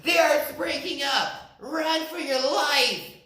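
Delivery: high, loud terrified shouting; high loud panicked animals. Acted. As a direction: shout as they flee. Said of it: "only shouted".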